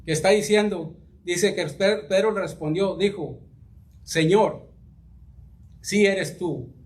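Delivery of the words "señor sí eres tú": "Señor", pause, then "sí eres tú" falling; affirmation. Acted. Preacher gives his reading of Peter's words: affirmation. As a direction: declare "sí eres tú" as certain, not asked. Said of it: "lo interpreté de esa manera".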